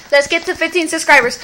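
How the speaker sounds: ordinary talk, nothing marked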